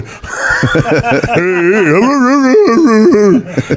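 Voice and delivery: high, hearty burst of laughter; big laugh. Real, not acted. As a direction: let out a big helpless laugh. Laughing at own story.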